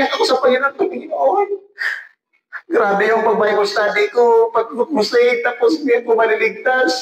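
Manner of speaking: anxious retelling. Retold or imitated. Preacher retells dream of not being saved.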